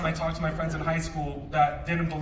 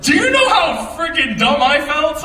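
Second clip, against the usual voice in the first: high, loud exasperation; practically shouting it out; loud exasperated; 9 semitones higher, 10 dB louder than usual. Real, not acted. Retelling humiliation, loud outburst.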